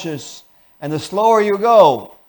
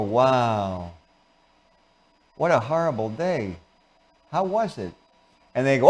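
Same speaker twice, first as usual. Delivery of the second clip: bored; 4 semitones lower than usual. Shown as a demonstration. Spouse coming home to an angry partner, bored. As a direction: drag every word out with no interest. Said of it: "You come in bored".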